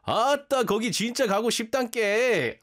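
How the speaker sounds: loud, high, fast Jeolla dialect quoting ChatGPT; Jeolla accent. Retold or imitated. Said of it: "He's like".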